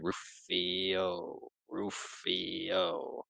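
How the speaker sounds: a soft, taunting call of the name, drawn out; taunting singsong. Retold or imitated. Hook taunting Rufio from the stairs, soft mocking call. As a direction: tease him by name from above.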